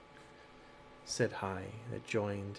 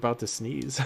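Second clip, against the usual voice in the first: ordinary talk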